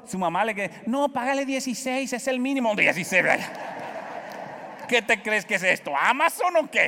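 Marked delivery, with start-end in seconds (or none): 0.9-2.7 wife's correction. Retold or imitated. Wife corrects the offered wage. 2.7-6.9 a father's hammed-up shock at paying sixteen an hour; mock outrage.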